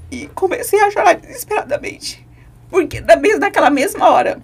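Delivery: fighting back tears as the memory hits; voice breaking. Real, not acted. Daughter recalling her father's deathbed, voice breaking with grief.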